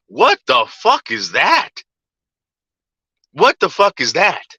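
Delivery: drawn-out, disbelieving question; incredulous outrage. Real, not acted. Streamer ranting about paying for life, incredulous. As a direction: swoop the pitch around in exasperated disbelief. Indignant at retold money demands.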